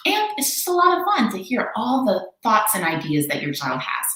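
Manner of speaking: ordinary delivery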